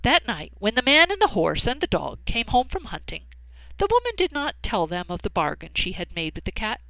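plain reading voice

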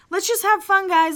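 Doug pleading. Retold or imitated. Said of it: "Doug jumps in and he's like".

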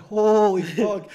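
high, slow, drawn-out shock. Retold or imitated. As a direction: stretch it out in disbelief. Friend shocked at sight.

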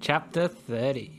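slow, grand title read; dramatic chapter announcement. Acted. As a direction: announce it like an audiobook.

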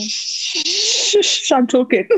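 high, laughing title read; laughing shush. Acted. Sister saying the podcast name while laughing. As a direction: giggle through the catchphrase. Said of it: "saying the podcast title playfully".

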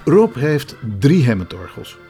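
normal talking voice

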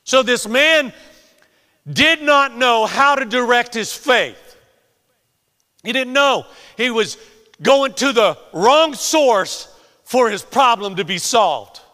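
high, loud emphatic delivery; full-force preaching. Real, not acted. Preacher explaining the man's misdirected faith, full-force preaching. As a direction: drive each phrase home at a shout.

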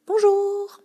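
high, bright bonjour sung out loud; cheery greeting. Shown as a demonstration. Shop assistant greeting a customer, high and cheery. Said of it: "je fais".

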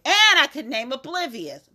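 ordinary delivery

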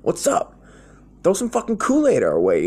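put-on begging kid. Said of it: "I'm like".

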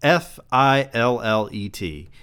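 letters of fillet spelled F I L L E T one by one; spelling it out. Shown as a demonstration. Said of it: "I almost said fillet".

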